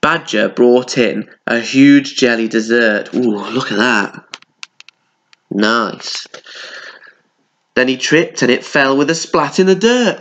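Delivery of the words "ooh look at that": ordinary delivery, nothing marked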